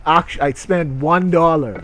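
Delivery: usual speaking voice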